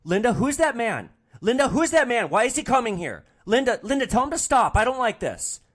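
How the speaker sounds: frightened wife crying out for Linda; panicked. Retold or imitated. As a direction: shriek at your wife about the stranger. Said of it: "visibly agitated".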